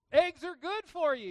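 high, loud mock health slogan; loud mock slogan, pitched high. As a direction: shout it like a headline. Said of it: "You ever heard that?".

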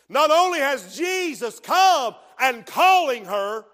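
loud slow shout from the pulpit; preaching at full force. Acted. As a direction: proclaim it to the congregation. Preaching climax.

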